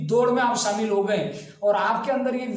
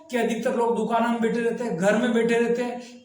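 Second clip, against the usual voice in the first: ordinary talk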